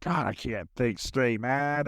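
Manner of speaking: usual speaking voice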